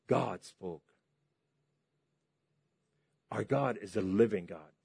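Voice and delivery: ordinary delivery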